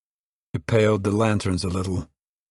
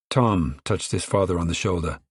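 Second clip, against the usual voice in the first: plain reading voice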